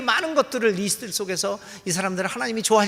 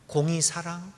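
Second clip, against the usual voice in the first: normal talking voice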